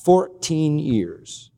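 Fourteen stressed, both words drawn out very slowly; a slow, weighted repeat of Fourteen years; slow emphatic echo. Shown as a demonstration. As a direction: let Fourteen years land slowly and heavily. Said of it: "for fourteen years he has not entered a church".

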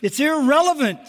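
normal talking voice

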